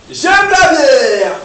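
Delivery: drawn-out, loud, high beer cheer; j'aime la bière cheer. Acted. Comedian to the crowd, stretched loud happy cheer. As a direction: belt it out like a drunk fan toasting. Dream self toasts beer onstage.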